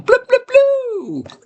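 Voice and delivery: very high, drawn-out silly bloops; playful bloop noises. Real, not acted. Host goofing for his live chat before greetings, playful high bloops. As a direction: sound out cartoonish high bloops, stretching them.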